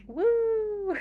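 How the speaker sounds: excited woo. Shown as a demonstration. Showing celebratory exclamations.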